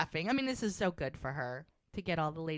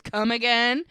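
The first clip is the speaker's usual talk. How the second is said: shocked come again; 4 semitones higher, 10 dB louder, slower than usual. Retold or imitated. Widow startled by seduction confession.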